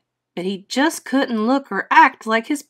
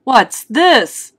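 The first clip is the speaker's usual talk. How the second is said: lightly voiced puzzled father, a bit higher and louder; father's question; 4 semitones higher, 7 dB louder than usual. A narrator's reading. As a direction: ask it as the father, lightly raised. Father asks about baby's hair.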